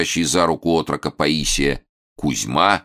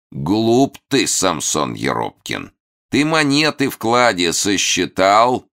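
mock evil villain; 6 semitones higher, 3 dB louder, slower than usual. Hermit mocking, menacing.

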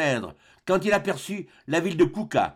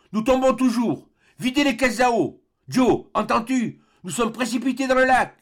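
higher, urgent shouted commands; frantic orders; 9 semitones higher, 4 dB louder than usual. Acted. Doctor urging Joe to empty the water casks, frantic shouting. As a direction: shout to Joe over the fall.

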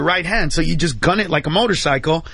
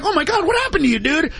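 much higher, astonished cry; stunned disbelief; 10 semitones higher, faster than usual. Retold or imitated. Carlos stunned by the goofy kid turning soldier, high astonished cry. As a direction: gasp it out amazed.

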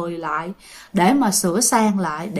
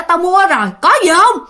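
high, feisty mock challenge; imitating a mother who won't back down; defiant challenge; 8 semitones higher, 4 dB louder than usual. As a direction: throw it back at the ghost. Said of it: "Cái mẹ hả cũng không vừa".

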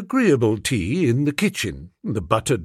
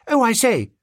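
put-on high-pitched young girl hailing someone; eager schoolgirl; 10 semitones higher than usual. Schoolgirl approaching the valet in the yard, eagerly. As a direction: pipe up as a schoolgirl.

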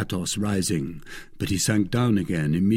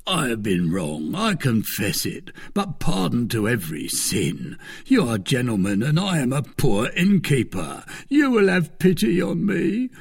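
humble host, pitch climbing into a plea; pleading innkeeper; 4 semitones higher than usual. Acted. Innkeeper begging the gentlemen for pity, pleading more and more. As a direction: beg for mercy, rising higher to the end. Host begs after bursting into tears.

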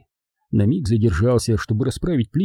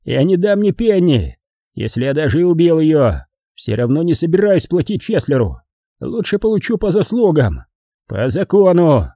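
grim determination, pitched up; resolved, grim man; 6 semitones higher, 4 dB louder than usual. Acted. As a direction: refuse firmly in a raised voice. Voices Giles refusing to pay.

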